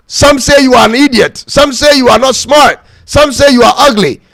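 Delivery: ordinary talk, nothing marked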